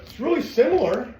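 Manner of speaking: high, loud insistence on really; pushing hard to convince viewers it is really similar; emphatic. Real, not acted. Trainer insisting to viewers his method is close, emphatic.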